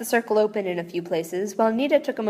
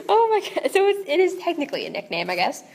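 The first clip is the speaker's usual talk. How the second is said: amused; 9 semitones higher than usual. Real, not acted. Still amused by the text.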